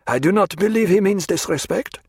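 high-pitched junior officer, protesting; earnest lieutenant. Acted. Red-haired lieutenant defending prisoner to colonel, earnest.

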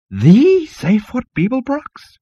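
very high, slow, amazed; awestruck. Acted. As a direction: gasp it out in awe. Awestruck insect.

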